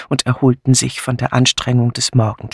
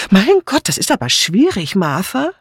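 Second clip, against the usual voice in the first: annoyed; 7 semitones higher than usual. A narrator's reading. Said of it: "fügte dann ärgerlich hinzu".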